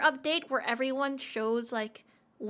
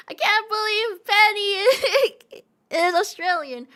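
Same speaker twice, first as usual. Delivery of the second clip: laughing dismay; 8 semitones higher, 8 dB louder than usual. Acted. Mock dismay over Penny.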